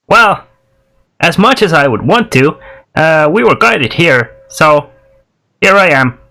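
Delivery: gnome character. Acted. Game master plays the gnome.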